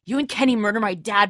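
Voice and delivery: usual speaking voice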